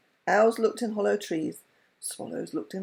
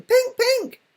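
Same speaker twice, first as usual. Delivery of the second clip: high, loud chirping call; bird call; 8 dB louder than usual. A narrator's reading. Chaffinch flying in to the wizard, calling high and loud. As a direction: chirp it out proudly.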